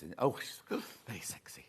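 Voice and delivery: whispered with a gasp; breathy whisper. Retold or imitated. Presenter gossiping about the new judge, whispered. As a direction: gasp then whisper it like a secret. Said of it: "a French lady who talks like this".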